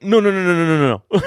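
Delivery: imitating a panicked cashier's no no no. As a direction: mimic a flustered cashier panicking. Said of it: "they, like, panic".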